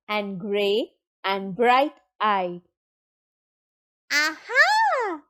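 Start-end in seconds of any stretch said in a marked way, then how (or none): 4.1-5.2 high triumphant "aha"; high-pitched gotcha; mouse's cry. A narrator's reading. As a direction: pop out with a high, gleeful cry.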